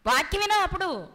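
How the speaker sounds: ordinary talk, nothing marked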